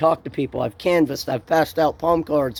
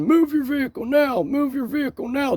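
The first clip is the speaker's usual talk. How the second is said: imitated shouted order, pitched much higher; mock yelling; 10 semitones higher than usual. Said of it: "started yelling at me and saying".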